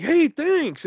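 high, loud retold phone hello; exaggerated pleasant reply; mock cheery thanks. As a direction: imitate chirpy thanks on a call.

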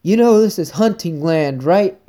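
a lightly voiced cross hunter; annoyed hunter. Hunter calling out from the middle of the woods, mock annoyed voice.

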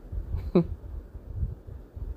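soft unvoiced rueful laugh; breathy tearful laugh. Real, not acted. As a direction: let out a weary laugh. Bitter laugh, tired of it.

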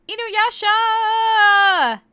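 long drawn-out loud wail of a name; crying for help. Acted. Chained Kagome calling for Inuyasha, long loud wail.

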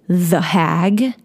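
goading, with a questioning lift at the end; taunting little question; antagonistic challenge. Retold or imitated. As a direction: needle him with it, voice rising on the last word. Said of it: "I felt so antagonistic".

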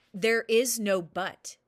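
loud, slow, cutting royal reply; booming warrior king. Acted. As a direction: slam the door on his protest. King cuts him off.

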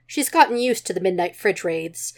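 ordinary delivery

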